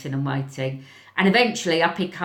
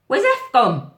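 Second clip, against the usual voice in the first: higher, louder worried call; alarmed question; 8 semitones higher, 7 dB louder than usual. Retold or imitated. Teacher asking where the missing boy went, alarmed. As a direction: ask with sudden alarm.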